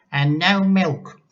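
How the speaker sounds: grandma demands tea from George, bossy and cranky. Acted. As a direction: order the boy about like a cranky old lady. Grandma voiced in story.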